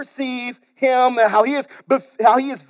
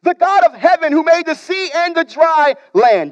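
emphatic quote; 7 semitones higher, 4 dB louder than usual. Acted. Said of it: "Jonah answers theologically".

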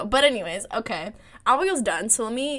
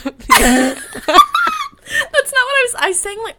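laughing; 11 dB louder than usual. Real, not acted. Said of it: "like a Valley girl".

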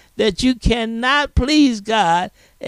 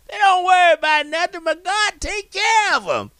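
lifted preaching; 9 semitones higher, faster than usual. Acted. Preacher voicing Jesus on lilies.

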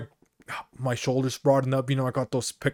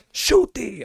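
mom's snap; 10 semitones higher than usual. Retold or imitated. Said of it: "She's like".

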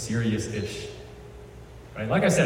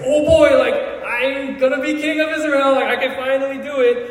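much higher and louder, bursting with glee; giddy excitement; 13 semitones higher, 5 dB louder than usual. Retold or imitated. David thrilled he will finally be king, giddy. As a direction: squeal it like a kid on Christmas.

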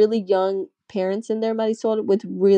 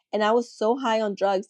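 ordinary talk

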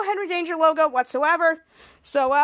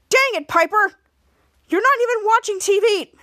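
louder, higher mock outburst; exasperated scolding; 5 semitones higher, 6 dB louder than usual. [name] startled by Piper's yell, exasperated mock outburst. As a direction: scold in pretend annoyance.

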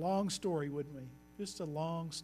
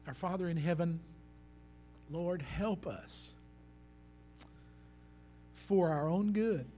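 prayer. Acted. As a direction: pray humbly over the congregation. Closing prayer.

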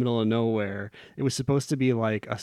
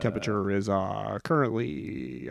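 airline pilot announcement; 3 semitones lower, 4 dB quieter than usual. Pretend. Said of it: "Start talking like a pilot".

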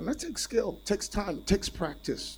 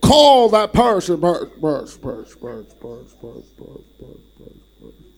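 mock voice fading into echo; 15 dB louder than usual.

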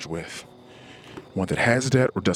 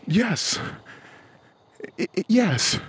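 emphatic yes; 10 semitones higher, slower than usual. Retold or imitated. Emphatic answer to wife.

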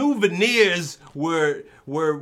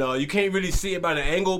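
ordinary talk, nothing marked

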